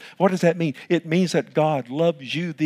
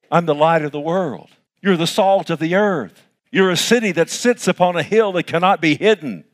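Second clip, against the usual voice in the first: loud ringing preacher delivery; preaching at full force; 3 semitones higher, 5 dB louder than usual. Acted. Pastor proclaiming to the congregation, loud and ringing. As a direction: proclaim it to the church.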